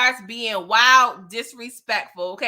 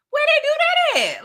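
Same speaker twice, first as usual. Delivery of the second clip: high, loud incredulous squeal; a loud squeaky disbelief at a rival's nerve; 6 dB louder than usual. Real, not acted. Incredulous reaction.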